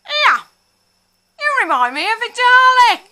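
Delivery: high, loud mocking old man voice; old man mimicked. Old man in Tesco telling her she looks like a Dalek, high mocking voice. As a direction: ham up a gummy old man. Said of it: "And he says".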